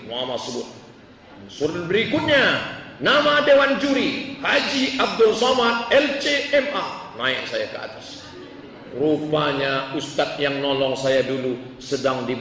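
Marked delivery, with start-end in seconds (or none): 1.4-7.2 high, formal MC announcement; announcer reading out names. Retold or imitated. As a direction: announce the jury member with ceremony.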